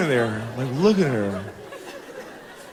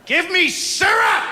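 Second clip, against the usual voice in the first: high, drawn-out demanding shout; slow, high-pitched toddler tantrum; angry three-year-old; 14 semitones higher, slower than usual. Acted. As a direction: bellow it like a tiny tyrant. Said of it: "she's a little ball of anger".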